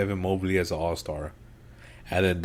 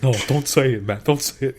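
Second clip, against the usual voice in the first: louder, higher imitation of a pleading friend; urgent mock protest; 5 semitones higher, 5 dB louder than usual. Friend stopping a Knicks fan's answer, urgent mock protest. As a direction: cut in hard, imitating a worried pal.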